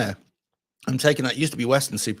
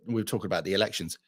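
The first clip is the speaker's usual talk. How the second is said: ordinary talk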